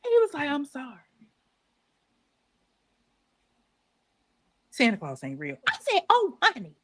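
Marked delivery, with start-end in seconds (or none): 0.5-5.6 imitating dad's soft, sheepish apology; hushed retold confession; subdued confession. Retold dad breaking the news. 6.1-6.7 high-pitched retold indignant comeback; mock indignation. Retold outraged reply to dad.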